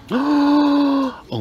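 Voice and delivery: high, slow, loud gasped oh my goodness; a loud, high, delighted swoon; thrilled. Real, not acted. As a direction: swoon over the find, drawing it out high. Thrilled find at the sale.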